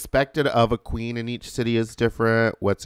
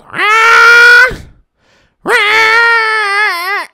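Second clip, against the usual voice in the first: shrill yowling cry, far higher and louder; cat scream; 14 dB louder than usual. Retold or imitated. Imitating the stray cat screaming at her window, shrill yowl. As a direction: imitate a stray cat's baby-like howl. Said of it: "he has a fucking scream".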